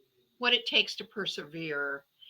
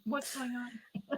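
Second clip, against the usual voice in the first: puzzled husband; 6 semitones higher, 8 dB quieter, slower than usual. Retold or imitated. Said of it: "my husband was going".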